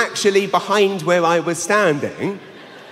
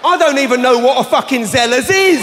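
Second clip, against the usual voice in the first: incredulous shout; 7 semitones higher than usual. Real, not acted. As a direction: yell it in disbelief. Incredulous rant at audience.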